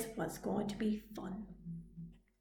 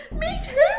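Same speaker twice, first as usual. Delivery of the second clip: howled; 9 dB louder than usual. A narrator's reading.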